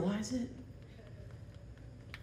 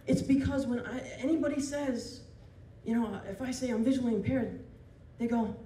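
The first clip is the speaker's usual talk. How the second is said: ordinary talk, nothing marked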